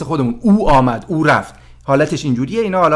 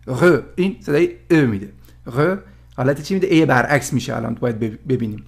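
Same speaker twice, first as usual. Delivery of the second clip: ordinary talk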